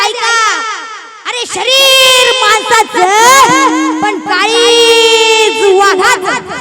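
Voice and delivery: loud, drawn-out kirtan preaching; fiery preaching. Acted. Preacher rousing the kirtan audience, fiery loud praise. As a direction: thunder the praise to the crowd.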